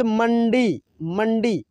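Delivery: मंडी said slowly with a clear retroflex nasal before ड; मंडी with clear ण sound; clear demo word. Shown as a demonstration. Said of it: "शब्द में कैसे उच्चारण होगा".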